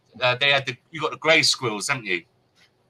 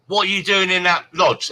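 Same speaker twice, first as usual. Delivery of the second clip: angry demand; 6 semitones higher, 5 dB louder than usual. Retold or imitated. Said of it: "from that bitch again saying".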